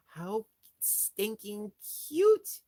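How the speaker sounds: gushing over a tiny rat; cutesy squeal. Real, not acted. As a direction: stretch it out in a delighted squeak. Cooing over rat toy.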